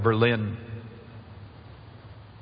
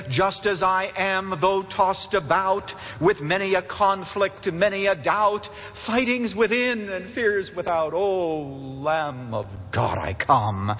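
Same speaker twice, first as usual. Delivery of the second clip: hymn recitation; 6 semitones higher, slower than usual. Acted. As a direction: recite the hymn slowly, settling down on the last line.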